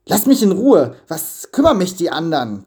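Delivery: loud, fast angry snap; hissed. A narrator's reading. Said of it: "zischte Avarizio".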